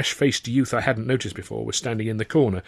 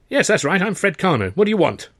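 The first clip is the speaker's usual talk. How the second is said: higher-pitched scornful youth; sneering; 7 semitones higher than usual. A narrator's reading. Cocky youth introduces himself to baffled visitor, sneering. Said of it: "the youth sneered".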